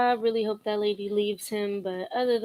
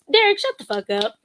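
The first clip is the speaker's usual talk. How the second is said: mock dismissal; 6 semitones higher, 6 dB louder than usual. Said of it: "Now it's like".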